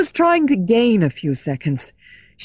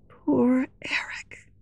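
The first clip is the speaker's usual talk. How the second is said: breathed, slow and unvoiced; soft whisper; 5 semitones higher, 6 dB quieter, slower than usual. A narrator's reading. Christine alone in her dressing room, whispering slowly. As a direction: whisper his name with pity. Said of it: "Raoul heard her softly say".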